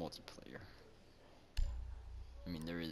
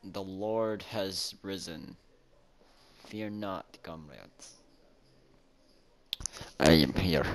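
mock proclamation; 10 dB louder than usual. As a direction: announce your arrival like a savior. Grand entrance as respawned player.

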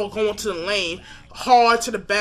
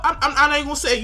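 laughing it off; 6 semitones higher, much faster than usual. Real, not acted. Laughs while holding back a word.

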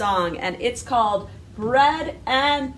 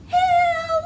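much higher squeaky little mouse hello. Shown as a demonstration. Teacher showing children a squeaky mouse hello. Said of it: "you need a squeaky mouse voice".

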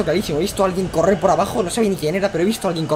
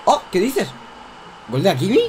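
ordinary delivery

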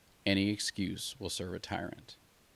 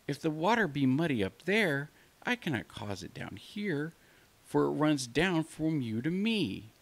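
timid lamb; 7 semitones higher than usual. Acted. Lamb reasoning with the wolf, high and meek. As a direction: reason meekly like a small lamb.

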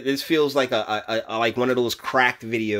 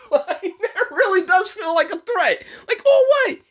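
squeaky high falsetto, laughing through the words; mock threat; 4 dB louder than usual. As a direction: ham up the offended tough guy, cracking up.

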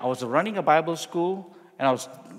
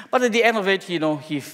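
usual speaking voice

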